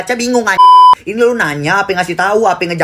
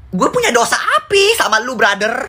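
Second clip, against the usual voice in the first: mock outrage; 4 semitones higher than usual. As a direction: pitch leaps far up in mock despair. Mock outrage at short reply. (protes pura-pura dengan nada sangat tinggi)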